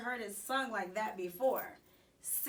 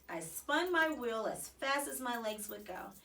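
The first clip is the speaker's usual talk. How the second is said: plain reading voice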